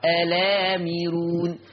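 "الآمرون" with no glottal stop, slow "alaamiroon"; hamza dropped. Shown as a demonstration. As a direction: hold the long aa after the open lam in "الآمرون". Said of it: "بقت لام مفتوحة". ("الآمرون" بلام مفتوحة ممدودة بلا همزة)

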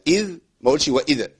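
إذ said as إذا, a vowel added after the dh; wrong vowel on dhal. Shown as a demonstration. Teacher demos the student error. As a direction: add a vowel to إذ. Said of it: "ما حركش الذال".

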